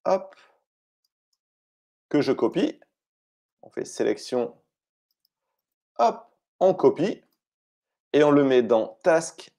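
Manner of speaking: ordinary delivery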